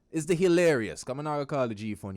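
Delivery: ordinary talk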